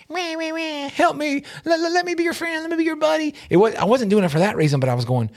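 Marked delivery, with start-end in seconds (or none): 0.1-3.3 high, soft, whiny mock plea; hammed-up pleading for friendship; mock whiny begging. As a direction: whine like a needy kid, much higher. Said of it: "I wasn't doing this like".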